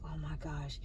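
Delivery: very soft, low aside; retold dismay. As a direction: drop right down, dismayed to yourself. Retold dismay while being robbed.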